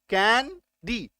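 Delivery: "Candy" very slow, कैं... डी; stretched, divided "candy" for counting syllables. Shown as a demonstration. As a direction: break "candy" into two separate beats. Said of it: "दो syllable आ गया".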